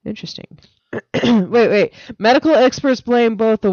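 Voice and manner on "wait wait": ordinary talk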